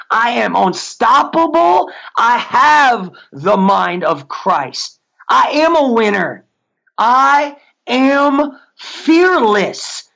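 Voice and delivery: slow declarations climbing in pitch, finally very drawn out; powerful affirmation. Shown as a demonstration. Coach modeling a winning confession, building, emphatic affirmation. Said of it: "say with some pitch and tone".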